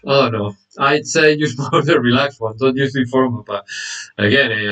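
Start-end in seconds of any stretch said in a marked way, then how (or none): none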